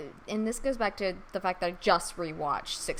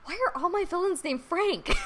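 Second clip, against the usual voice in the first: higher-pitched self-directed complaint; mock exasperation; 8 semitones higher than usual. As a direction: ask yourself in mock dismay. Retold sudden exasperated realisation.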